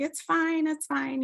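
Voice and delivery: put-on high, light child voice brushing it off; a little kid shrugging off mom's question.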